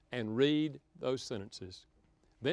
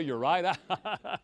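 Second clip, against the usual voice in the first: laughing mock compliment; 3 semitones higher, 4 dB louder, much slower than usual.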